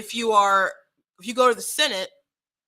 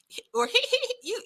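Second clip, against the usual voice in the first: ordinary talk, nothing marked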